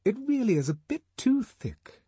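a high-pitched headmistress tutting at gossip; headmistress of Eton. A narrator's reading. Headmistress complaining to the director, high disapproving voice. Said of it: "the headmistress of Eton was saying".

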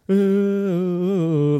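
drawn-out chanting hoot; imitated crowd chant. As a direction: mimic a stadium chop chant. Imitates the tomahawk chop chant.

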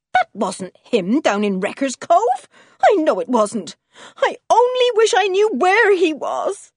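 high, agitated protest, climbing at the end; overwrought, loud outrage; indignant and worried. A narrator's reading. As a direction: protest hotly, rising into worry. Wife angry and worried for husband.

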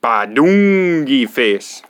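slow, loud nonsense babble with squeaks; silly gibberish. Acted. Reader forced to say the nonsense words, loud gibberish. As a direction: ham up the gibberish, wildly. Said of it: "make silly sounds".

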